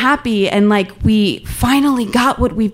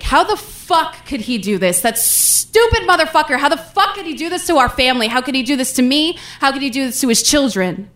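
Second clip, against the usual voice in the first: higher, fast, furious outburst; furious crying rant; 5 semitones higher than usual. Retold or imitated. Mom at the kitchen table cursing dad, furious. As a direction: rage at him through the tears. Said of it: "but she's pissed".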